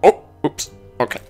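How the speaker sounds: normal talking voice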